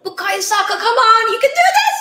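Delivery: will the player on, building to a cry; urgent cheering. Real, not acted. Cheering the penalty taker on.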